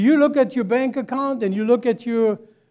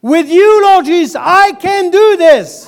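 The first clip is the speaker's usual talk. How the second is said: higher and much louder, slow; preaching at full force; 8 semitones higher, 11 dB louder than usual. Acted. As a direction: proclaim it over the church. Pastor leads call and response.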